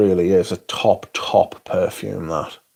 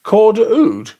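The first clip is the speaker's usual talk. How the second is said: high, loud disbelieving "Coeur d'Oud?"; can't believe what he just heard; incredulous; 11 semitones higher, 6 dB louder than usual. Real, not acted. Disbelief at the TikTok trend claim.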